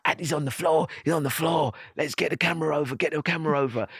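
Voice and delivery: excited crew voice calling for cameras; producer on the walkie-talkie. Retold or imitated. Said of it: "I could hear him on the walkie-talkie".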